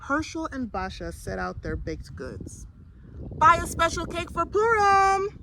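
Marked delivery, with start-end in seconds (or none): 3.4-5.4 high, loud market cry; calling out. A narrator's reading. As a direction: hawk cakes to the whole market. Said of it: "Basha called".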